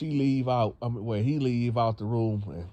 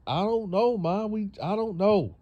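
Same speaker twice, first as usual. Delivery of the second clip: put-on whiny teen daughter; 10 semitones higher than usual. Daughter whines.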